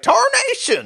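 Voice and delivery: very high cartoon Western exclamation; squawked mock-Western cook voice; Western camp cook accent. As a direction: yelp it like a startled Western cook. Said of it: "And Cookie's like".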